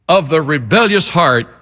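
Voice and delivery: ordinary delivery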